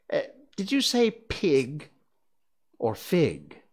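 slow, teasing cat voice; amused cat, taking its time; snooty bored cat. Acted. The Cheshire Cat voiced in story.

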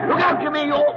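high, loud cry of alarm; shouted warning. Acted. Passenger to driver nearly off the road, shouted warning. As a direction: yell out to stop a crash.

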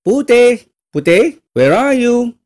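shrill-free high loud call for Putih; stepmother calling out. Retold or imitated. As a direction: call the girl's name across the house. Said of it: "the stepmother was looking for putih".

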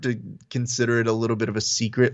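ordinary talk, nothing marked

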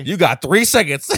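loud, high barked countdown; shouted threat. Retold or imitated. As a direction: yell the warning at her. Threatening voice in retold scene.

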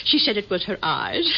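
ordinary talk

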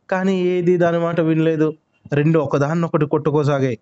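ordinary delivery, nothing marked

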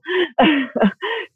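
high burst of laughter. Real, not acted. Guest laughs after calling herself [name], high laugh.

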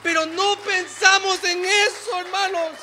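preaching shout. Acted. Pastor shouting at the church about eternity, loud and high. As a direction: shout it over the congregation.

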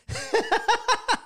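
a sudden high cackle at his own story; high burst of laughter. Real, not acted. Cracking up while telling his friend about the day.

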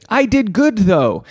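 put-on high, childlike boast; husband defending himself to his wife, childlike; mock proud defense. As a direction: say it like a kid proud of a chore. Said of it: "my defense to say".

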